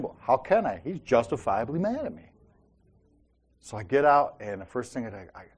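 ordinary talk

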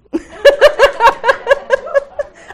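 very high, loud burst of laughter. Real, not acted. Speaker laughing at her own workplace joke, loud and high. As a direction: crack up at your own joke.

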